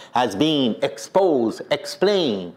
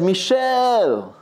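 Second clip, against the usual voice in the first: calling voice; 8 semitones higher than usual. Retold or imitated. Mysterious voice calls his name.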